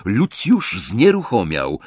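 ordinary delivery

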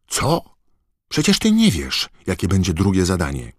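high, loud startled question; astonished. A narrator's reading. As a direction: gasp in disbelief. Harry astonished.

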